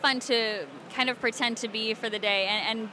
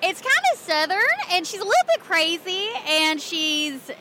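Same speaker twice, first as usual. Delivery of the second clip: higher-pitched, sassy Southern accent; crazy Southern character; 4 semitones higher than usual. Shown as a demonstration. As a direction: play a slightly crazy, rude Southern girl. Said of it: "Southern crazy me".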